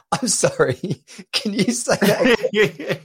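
retold apology through laughter; laughing. Patient asking doctor to repeat, trying not to laugh. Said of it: "And I'm laughing".

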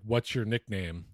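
ordinary talk, nothing marked

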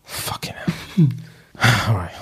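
usual speaking voice

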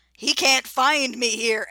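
mock alarm of a man in hiding; slow, loud imitation of a banker; panicked banker. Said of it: "the banker's like".

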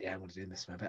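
quiet, dropped-down resigned yes; resigned reply. Retold or imitated. Comic answering the booker, quiet and resigned. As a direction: give in to the request with a soft sigh. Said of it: "And I'd be like".

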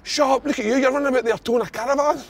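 retold indignant bark. Big defender firing back at a moaning teammate, indignant. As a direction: imitate a teammate snapping back high-pitched.

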